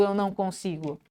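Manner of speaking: baixo e grave, caindo; desanimado. Shown as a demonstration. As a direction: diga sem força, como quem desiste. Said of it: "Olha a diferença do". (voz baixa de desânimo)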